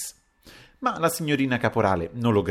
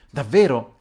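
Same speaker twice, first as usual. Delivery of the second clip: louder, surprised Davvero; amazed exclamation; 4 semitones higher, 7 dB louder than usual. A narrator's reading. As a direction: sound genuinely astonished. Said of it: "esclamò Papiano ... con una meraviglia". (stupore esclamato)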